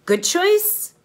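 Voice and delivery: sing-song rising "Good choice"; upbeat good choice. Shown as a demonstration. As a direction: lift "choice" up high and loud, like a cheerful prompt. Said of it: "you'll show me with your hands".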